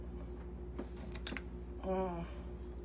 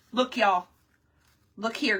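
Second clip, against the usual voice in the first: ordinary talk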